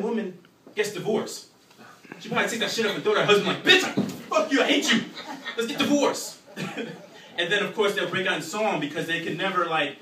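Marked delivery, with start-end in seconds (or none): 3.6-6.4 higher, louder laughing rant; mock angry yell. Imagined divorcing wife yelling at her husband, laughing. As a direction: throw it out in a hammed-up rage.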